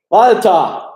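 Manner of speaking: loud high shout across the house; calling out. Real, not acted. Father calling his son Walter from the stream, loud shout. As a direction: yell a name to another room.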